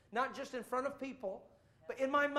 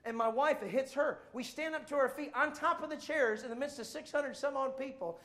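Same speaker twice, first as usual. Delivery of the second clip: ordinary talk, nothing marked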